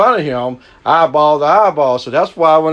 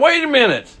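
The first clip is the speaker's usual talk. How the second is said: high, incredulous "Wait a minute"; first high "Wait a minute", stopping the excuse; incredulous stop; 11 semitones higher than usual. Retold or imitated. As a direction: halt him in disbelief. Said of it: "I'm thinking in my mind".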